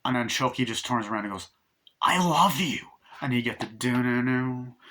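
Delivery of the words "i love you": higher-pitched mock declaration of love; acted declaration. Chucky turning round to Tiffany, hammed-up declaration. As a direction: declare it in a raised put-on voice.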